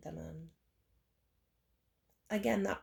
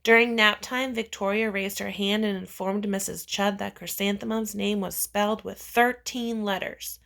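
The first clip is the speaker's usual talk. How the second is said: ordinary delivery, nothing marked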